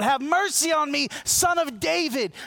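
loud, high plea for mercy; crying out. Retold or imitated. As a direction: call out desperately like the blind beggar. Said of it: "He cries out".

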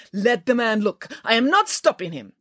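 huffy official's voice; exasperated. Acted. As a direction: protest your innocence pompously. Exasperated director protesting.

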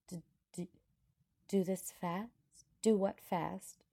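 high, flustered stammering question; alarmed stammer. A narrator's reading. Alarmed heroine stammers back his words.

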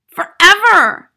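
slow, high, loud cry; distraught child. A narrator's reading. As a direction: draw the word out in dismay.

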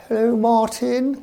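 high, slow posh greeting; posh chairman. Retold or imitated. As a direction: put on a plummy chairman voice. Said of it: "He's very posh".

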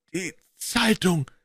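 slow, croaking voice of a half-hanged man. A narrator's reading. The revived man croaks from behind them, slow and rasping. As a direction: croak it out weakly. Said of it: "krächzte eine Stimme".

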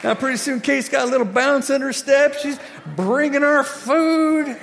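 high, loud, gleeful telling; excited shouted narration; exuberant storytelling. Retold or imitated. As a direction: keep the celebration going in your voice. Teller still exuberant about Kate.

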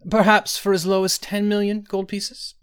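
high, hurried haggling offer; quick interjection. A narrator's reading. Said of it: "said Iachesis quickly".

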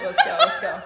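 high laughing urging; excited. Real, not acted. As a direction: egg them on, laughing.

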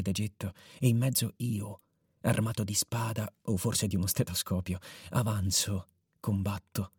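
soft, low storytelling voice; story narration. A narrator's reading. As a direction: narrate quietly as if at night.

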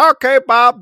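high, loud hammy commentator; wrestling commentator. Retold or imitated. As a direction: shout it like a hammy announcer. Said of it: "commentators of our fake wrestling".

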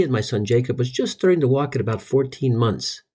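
normal talking voice

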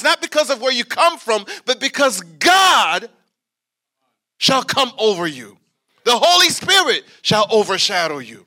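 rising, ringing preacher's cadence; full-force preaching. Real, not acted. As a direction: preach it out, building.

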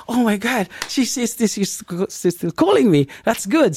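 light up at the news; excited relief. Retold or imitated. Telling listeners his joy that she called back, excited. His reaction to her call.

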